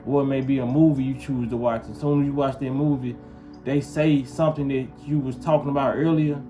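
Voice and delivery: ordinary talk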